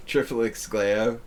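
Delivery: mild, drawn-out mock yell; mock scream. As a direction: imitate a scream slowly, only half raising the voice. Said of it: "screaming, like".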